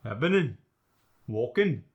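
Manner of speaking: "happening, walking" with each final g dropped to a plain n; Scottish dropped G. Shown as a demonstration. Dialect coach's partner showing the dropped G, Scottish "happening, walking". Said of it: "drop the G at the end of words".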